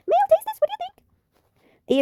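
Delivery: very high, slow, drawn-out offer; a high sing-song hostess pushing a drink; high character voice. Acted. Mel urging the newcomer to taste, in a very high slow voice.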